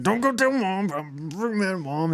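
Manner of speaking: lightly acted sulk about mom; sulky younger self. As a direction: whine it like a sulking kid brother. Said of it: "And I was like".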